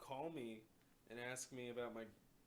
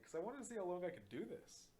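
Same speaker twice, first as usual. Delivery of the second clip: gleeful; 7 semitones higher than usual. Retold or imitated. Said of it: "I'm like".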